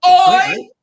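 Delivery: Irish Oi. Retold or imitated. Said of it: "And then he'll go".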